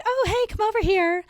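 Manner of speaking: high, sing-song call to the dog; friendly high-pitched dog call; sweet recall call. Retold or imitated. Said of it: "we're like".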